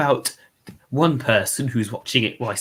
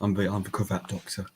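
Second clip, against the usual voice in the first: lower, much softer actor voice; Connor impression; 4 semitones lower, 10 dB quieter than usual. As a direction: murmur it like the cravat Doctor. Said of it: "I could probably do Connor".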